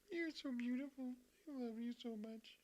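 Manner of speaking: high, soft doting coo; cooing softly to her cat. Real, not acted. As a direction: adore the cat in a tiny sweet voice.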